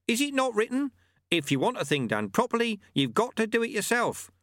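high-pitched master quoting a maxim; sage old monk. Acted. Monk quoting wisdom as he takes over, sagely. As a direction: recite the saying high, like an old teacher. Lu-Tze quotes a saying.

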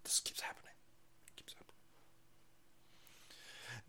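breathy hushed whisper. Pretend. A mock critic whispering to himself. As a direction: whisper it like a secret.

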